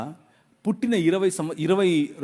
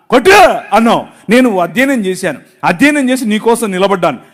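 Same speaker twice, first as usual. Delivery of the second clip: loud, bitter accusing prayer; angry complaint to God; 3 semitones higher, 9 dB louder than usual. Retold or imitated. Pastor reliving his loud bitter quarrel with God. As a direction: hurl every grievance at God, voice raised. Retold outburst against God.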